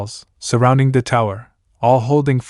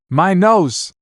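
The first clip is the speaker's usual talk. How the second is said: high-pitched, mild yelp of hurt; in pain; 7 semitones higher than usual. A narrator's reading. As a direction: cry out lightly as if struck. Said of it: "said the gorilla in pain".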